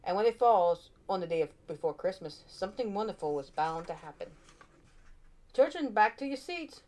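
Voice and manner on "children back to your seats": calling out. A narrator's reading. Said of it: "called the teacher".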